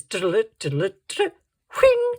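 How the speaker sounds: vocal diddle-it run rising to a high whing; piano flourish imitation. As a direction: mimic the tinkling piano ending.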